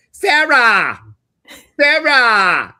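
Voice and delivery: high, very loud holler with a drawn-out AH in Sarah; long, loud name-call. Retold or imitated. Medium imitates stranger's grandmother calling her, long loud holler. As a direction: imitate a grandmother yelling Sarah, stretching the last vowel as it falls. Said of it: "The ah was really long and loud".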